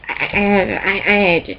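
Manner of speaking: ordinary talk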